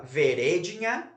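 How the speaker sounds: "veredinha" stressed on the second syllable, ve-RÉ-di-nha, rising; wrong stress on RE. Shown as a demonstration. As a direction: move the beat of "veredinha" onto an open RÉ. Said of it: "é a penúltima sílaba". ("veredinha" com acento errado no RÉ)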